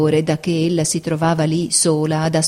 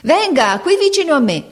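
shouting; 9 semitones higher than usual. Acted. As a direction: call her to your side. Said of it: "gridava una". (gridato acuto e forte)

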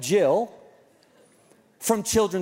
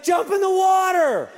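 urgent mock yell; 10 semitones higher, 4 dB louder than usual. Dad to sunscreen-blinded son, urgent high shout. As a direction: holler the order in hammed-up alarm.

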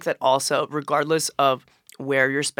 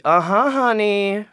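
slow, high, sassy drawl; 7 semitones higher, 6 dB louder, slower than usual. Acted. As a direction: drawl the name with sass. Reads the product name in character.

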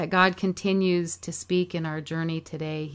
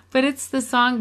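laughing; 6 semitones higher, 5 dB louder than usual. Real, not acted. Laughs after co-host's joke.

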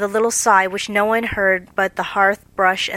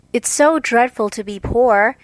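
sighing; 3 semitones higher, 3 dB louder than usual. A narrator's reading. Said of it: "sighed Meg".

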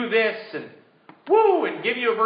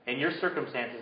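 ordinary talk, nothing marked

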